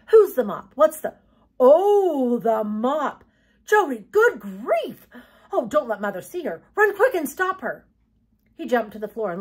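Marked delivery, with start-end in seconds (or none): 0.1-7.9 loud, high, dismayed outburst; dawning alarm. Acted. Boy remembering the guest, alarmed. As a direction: go from groggy question to panicked order.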